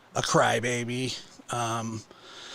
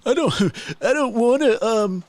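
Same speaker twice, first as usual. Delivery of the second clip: high, mocking whine of a man backing down; mock whiny backdown; 8 semitones higher than usual. Mocking him as he calms down after a rant, in a high whine. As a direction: whine it high like a sulky child giving up. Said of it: "and he's like".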